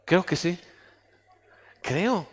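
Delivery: low, soft, hesitant "creo"; half-hearted reply, barely committing to "creo"; unsure answer. Acted. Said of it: "así como que".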